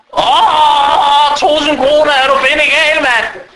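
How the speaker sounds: high-pitched, hammed-up outcry with a drawn-out "åh"; mock screaming outrage. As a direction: shriek it like an outraged wife turning down a loan. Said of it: "Hun råber og skriger, hele naboen hører det". (spillet råben og skrigen)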